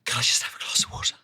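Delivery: fast, breathless whisper; whispering hoarsely after screaming takes. Retold or imitated. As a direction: whisper it, voice gone. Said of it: "I'm like".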